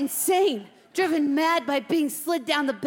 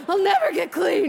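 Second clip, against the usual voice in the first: hysterical; 7 semitones higher, 5 dB louder than usual. Acted. Repeats, hysterical.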